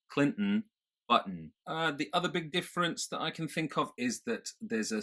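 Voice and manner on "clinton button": "Clinton", "button" with an unreleased T; nasal release in "Clinton", "button". Shown as a demonstration. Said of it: "that little mm sound in my nose".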